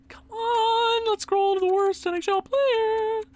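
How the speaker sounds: very high, strained whine; high-pitched frustrated singsong; exasperated whine. Real, not acted. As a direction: squeal it out in mounting impatience. Exasperated scrolling through players.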